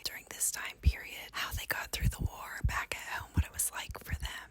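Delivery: soft unvoiced ASMR whisper. Shown as a demonstration. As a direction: whisper close to the mic, gently. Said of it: "was an A S M R whisper".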